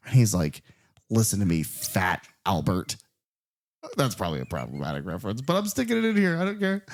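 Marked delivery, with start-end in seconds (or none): 1.1-3.0 low, slow menacing growl; mock menacing boss. Host imitating boss threatening Loretta, low slow menace. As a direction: lean in low and slow like a thug.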